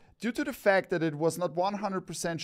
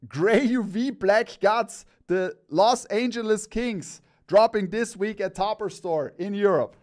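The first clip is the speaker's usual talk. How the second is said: louder, higher hype announcement; excited; 4 semitones higher, 4 dB louder than usual. Real, not acted. Host announcing the Kings hat drop to viewers, excited. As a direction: hype up the product drop, bursting into laughter by the end. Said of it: "I'm so excited".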